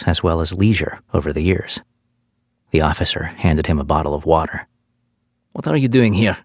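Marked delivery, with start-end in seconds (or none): 5.5-6.4 put-on French accent. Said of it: "he asked with his thick accent".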